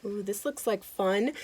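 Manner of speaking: plain reading voice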